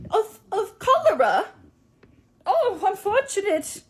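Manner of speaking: put-on high, slow quaint old-fashioned voice; olde-timey shopkeeper. Costumed shopkeeper answering the teacher in olde-timey speech. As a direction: play a clueless pioneer shopkeeper.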